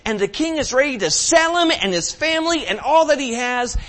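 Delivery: high, loud storytelling sermon; impassioned sermon; preaching at full force. Acted. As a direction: preach the parable with fire.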